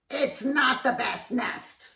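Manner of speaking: disdainful bird wife; sniffy Missus Bird. Acted. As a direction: look down your beak at it. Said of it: "she said".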